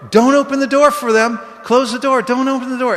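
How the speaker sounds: very high, loud frantic yell; yelling through the door. Retold or imitated. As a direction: scream a warning across the hallway. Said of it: "she's yelling through the door".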